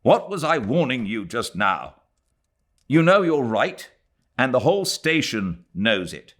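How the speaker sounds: put-on young man, firm. A narrator's reading. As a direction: set her straight. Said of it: "He turned on her with".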